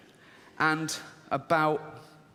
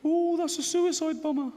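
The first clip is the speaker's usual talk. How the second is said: fearful inner voice; 11 semitones higher than usual. Retold or imitated. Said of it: "this one goes".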